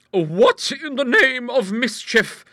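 loud, high, drawn-out exclamation; high-pitched outburst, stretched for emphasis; startled cry. A narrator's reading. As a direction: cry out in indignant astonishment. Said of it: "cried a voice".

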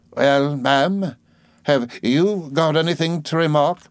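a hint of a tart old aunt; aunt prodding Miss Murdstone to speak; brisk aunt. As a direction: challenge her, lightly voiced. Aunt voiced in story.

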